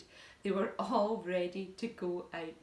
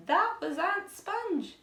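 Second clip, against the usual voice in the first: high, slow triumphant shout; 11 semitones higher, 8 dB louder, slower than usual. A narrator's reading. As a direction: yell it out as the peach hits her.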